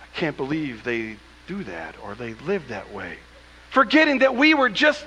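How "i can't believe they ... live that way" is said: softer, mock holier-than-thou disdain; judgmental scoff. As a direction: look down your nose at them, quietly.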